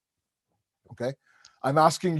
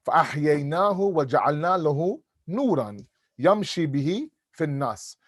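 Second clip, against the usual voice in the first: Quran recitation; much faster than usual. Acted. Quotes Quran verse in Arabic.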